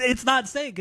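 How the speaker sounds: "sync" said as sank, pitched high; wrong vowel. Shown as a demonstration. Correcting cohost's vowel in exasperation, wrong vowel. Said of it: "It's not S A N K".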